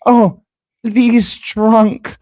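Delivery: mocking accusers. Streamer imitating those who call him drunk, mocking. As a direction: pitch it up high, mimicking the accusers. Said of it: "wanna sit there and go".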